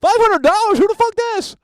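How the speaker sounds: very high, loud disbelief; shocked yell. Real, not acted. Host hearing caller sent five hundred dollars, shocked high yell. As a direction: yelp it, stunned by the amount.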